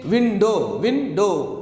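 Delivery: window broken into win and do; split into syllables. Shown as a demonstration. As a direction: count out window as two beats. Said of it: "win and do".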